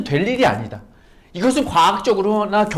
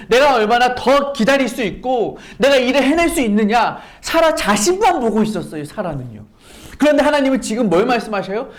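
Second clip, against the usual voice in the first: usual speaking voice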